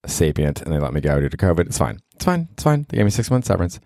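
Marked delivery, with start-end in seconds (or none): 1.7-3.8 sarcastic it's fine. Real, not acted. Being laid off, brushing off.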